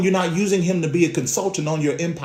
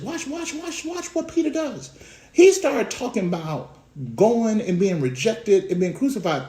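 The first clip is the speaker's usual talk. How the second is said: ordinary talk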